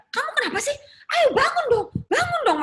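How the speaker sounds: very high, pleading urgent voice; high-pitched insistent plea; imitated frantic mother. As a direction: act out mom trying to rouse dad. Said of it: "Mami saya tuh masih nampar-nampar dia".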